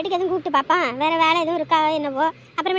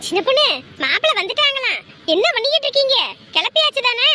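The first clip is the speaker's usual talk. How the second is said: high-pitched, raised hurrying call; urgent calling out; 7 semitones higher, 4 dB louder than usual. Acted. Calling to the women as groom arrives, high urgent hurrying. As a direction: check loudly that everyone is ready. Calls to hurry bride's helpers.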